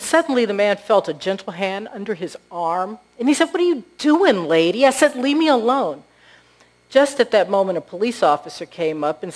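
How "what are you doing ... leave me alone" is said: higher, louder acted protest; indignant protest. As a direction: push her off with loud irritation. Startled homeless man protests to woman.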